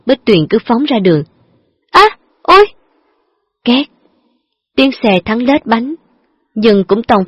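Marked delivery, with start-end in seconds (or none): 1.9-2.7 sharp high cry of pain; girl struck by the car cries out in pain. Acted. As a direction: yelp as the car hits.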